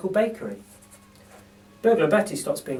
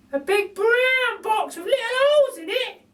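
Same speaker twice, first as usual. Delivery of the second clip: very high, slow, amazed repeat; staggered by the news; Burglar Betty, astonished; 6 dB louder than usual. A narrator's reading. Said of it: "says Burglar Betty".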